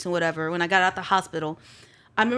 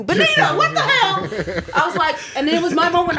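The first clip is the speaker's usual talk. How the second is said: much higher, laughing, stretched-out what the hell; laughing disbelief; 8 semitones higher, 5 dB louder than usual. Real, not acted. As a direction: laugh in outrage at the absurdity.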